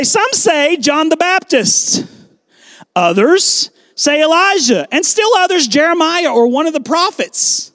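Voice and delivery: disciples answering. Acted.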